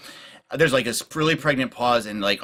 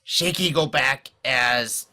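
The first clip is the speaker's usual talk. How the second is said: slow, pidgin goblin voice, often pitched up; Shaky, scheming goblin; 3 semitones higher, much slower than usual. Acted. Goblin advising allies on attack plans, slow and deliberate. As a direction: think it through slowly in broken speech. Game master speaks as Shaky.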